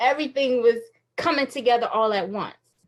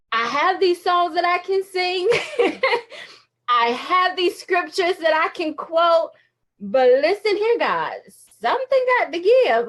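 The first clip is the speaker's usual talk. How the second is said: pleading with God, laughing; 5 semitones higher, 4 dB louder than usual. Retold or imitated. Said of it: "being able to say".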